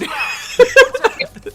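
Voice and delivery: high laugh. Real, not acted. Laughing at his own story.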